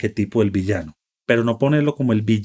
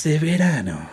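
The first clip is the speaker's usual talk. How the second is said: high dramatic trailer announcer; movie trailer voice; 6 semitones higher than usual. Shown as a demonstration. Joins movie trailer voice bit.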